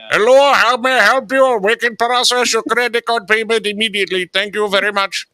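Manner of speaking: squeaky eager phone supervisor; high put-on supervisor voice. Supervisor taking over the call, high put-on voice.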